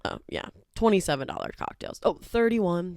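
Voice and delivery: ordinary delivery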